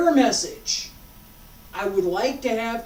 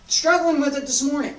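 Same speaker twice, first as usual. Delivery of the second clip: real frustration; 5 semitones higher, 7 dB louder, faster than usual. Real, not acted. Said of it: "You can hear it in my voice".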